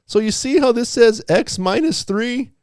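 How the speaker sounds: eager student making a case; imagined student voice. Retold or imitated. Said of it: "And they said".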